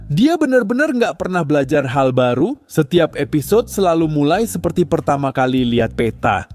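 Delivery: ordinary delivery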